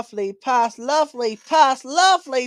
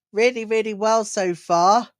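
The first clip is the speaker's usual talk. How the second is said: ordinary talk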